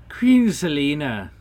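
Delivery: high, loud, urgent address; emphatic storybook leprechaun; concerned leprechaun. Acted. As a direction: hail the queen with concern.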